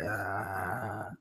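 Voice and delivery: low creaky fry on a soft "Ah"; vocal fry. Shown as a demonstration. Streamer demonstrating vocal fry to chat, creaky. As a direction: croak the "Ah" down in fry. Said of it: "Vocal fry means you talk like that".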